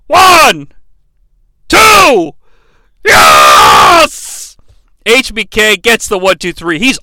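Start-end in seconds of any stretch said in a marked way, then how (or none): 0.1-4.6 loud count building to a roar; shouted pin count. Acted. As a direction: yell the count like a wrestling crowd. Counts the pin like ringside crowd.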